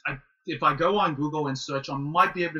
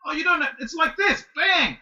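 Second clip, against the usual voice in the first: grumpy sifu; 11 semitones higher than usual. Retold or imitated. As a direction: imitate the cranky master slamming the dummy.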